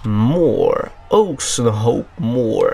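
mock posh English pronunciation of "Oxenhope Moor", each syllable stretched; best English accent. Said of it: "Je sors mon meilleur accent anglais".

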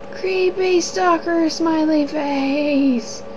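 creepy voice. Pretend. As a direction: draw it out like a creep. Reading a creepy emoticon name.

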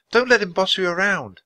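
put-on high-pitched girl's plea; high, earnest little girl voice; urging girl. A narrator's reading. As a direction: urge the bird to stand up for itself. Annie begs the bird to resist.

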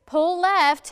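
loud urgent command; shouting. Acted. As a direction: bark it across the water. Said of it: "Head shouted to Arms".

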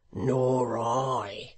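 understated hearty vociferation; vociferated. A narrator's reading. Hangman seconding Hugh, vociferating. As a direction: declare it roughly as the hangman. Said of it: "vociferated Dennis".

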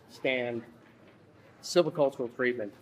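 ordinary delivery

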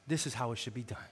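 low, hushed inner thought; quiet awe. Retold or imitated. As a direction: say it softly to yourself, moved. His awed thought at the funeral.

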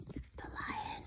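unvoiced hush on the name; whisper. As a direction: whisper so the lion won't wake. Teasing kids about sleeping lion.

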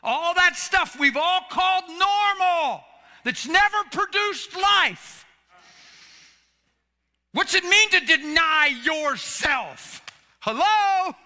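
high, loud preaching cry; full-force preaching. Acted. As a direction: preach it out to the whole room.

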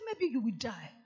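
a hint of dread, voice dropped low; low worried quote. Fearful man sighs to himself, low and worried. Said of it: "He said".

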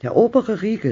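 put-on high, strained call of the old wife; loud call. A narrator's reading. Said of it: "rief sie laut".

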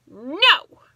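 loud, defiant no; stubborn no. A narrator's reading. Stubborn kitten refuses bedtime with a loud no. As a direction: plant your feet and refuse. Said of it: "But baby blue cat said".

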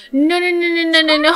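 panic-stop the scene with rapid no's; frantic no no no. Real, not acted. Watching the sims start a fight, frantic. Reacting to sim chaos.

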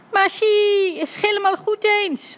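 very high, jubilant cry; excited shout. A narrator's reading. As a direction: cheer your agreement at the top of your voice. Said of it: "riep Dummy". (uitgelaten, hoog geroep)

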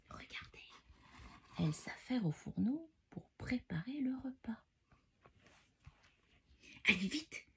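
hushed storytelling whisper. Acted. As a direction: whisper like sneaking through a castle. Reading bedtime book in whispers.

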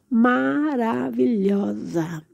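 very slow, savoured praise; drawn-out admiration. Real, not acted. Vlogger praising a plant to viewers, slow admiring gush. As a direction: linger on each syllable in wonder. Gushing over succulents on display.

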